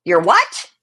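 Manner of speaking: loud mock-granny disbelief; loud, acted incredulous question; shocked grandmother. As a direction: blurt it as a startled grandmother. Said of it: "and she went".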